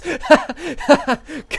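high loud cackle; loud delighted laugh; burst of laughter. Real, not acted. Laughs at own option.

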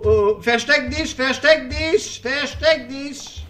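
high, slow frightened pleading; frightened urging. Real, not acted. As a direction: beg them to hide. Alien arrives, panic.

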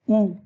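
ou as one "oo" vowel, set apart; pronunciation demo. Shown as a demonstration. Teacher showing how O, U is pronounced, pronunciation demo of ou as "oo". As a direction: say ou as a single "oo". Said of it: "O, U se prononce".

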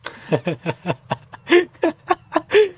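long, high peals of laughter; amused laugh. Real, not acted. Player reacting to the game's radio call, long high laughing. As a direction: crack up in high bursts.

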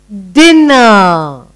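"dinner" stretched long on both syllables; syllables drawn out. Shown as a demonstration. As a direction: draw out each syllable of "dinner". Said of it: "we're doing two syllable word".

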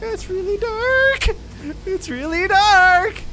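scared whine. Real, not acted. Scared whining.

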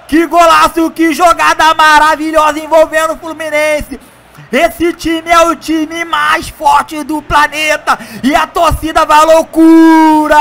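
play-by-play commentary. Acted. Commentary after the goal.

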